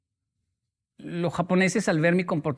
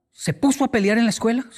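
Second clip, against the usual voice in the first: higher, louder scandalized question; mock shock; 5 semitones higher, 4 dB louder, faster than usual. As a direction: feign outrage at the news. Adulto escandalizado. (pregunta escandalizada en broma)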